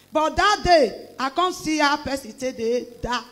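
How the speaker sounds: ordinary talk, nothing marked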